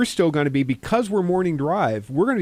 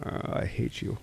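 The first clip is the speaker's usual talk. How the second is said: whispered, grudging mock hatred; 5 semitones lower, 11 dB quieter than usual. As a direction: breathe it out like a defeated friend. Grudging friend agrees to early shift.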